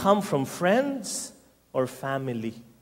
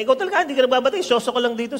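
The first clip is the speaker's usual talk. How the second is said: scolding brother; 7 semitones higher, much faster than usual. Acted.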